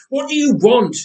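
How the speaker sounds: high, loud desperate cry. A narrator's reading. Jacob shouts at his silent attacker, high and loud. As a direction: demand it at the top of your voice. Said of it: "Jacob cried".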